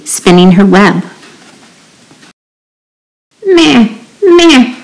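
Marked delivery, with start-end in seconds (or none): 3.4-4.8 high, falling goat bleat. A narrator's reading. Goat calling to the spider, high bleats. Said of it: "said the goat".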